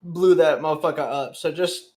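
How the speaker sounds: normal talking voice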